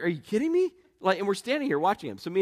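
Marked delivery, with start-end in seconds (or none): 0.0-0.7 disbelief. Retold or imitated. Cop watching a looter.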